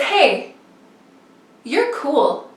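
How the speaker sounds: ordinary talk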